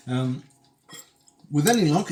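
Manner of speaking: normal talking voice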